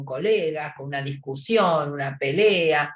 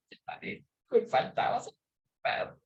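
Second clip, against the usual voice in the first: breathy, choked whisper through tears; tearful whisper; 5 semitones higher, 7 dB quieter than usual. Retold or imitated. Friend on the couch sobbing over the dead dog, choked whisper. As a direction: whisper it as if crying too hard to voice. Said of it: "Y estás llorando".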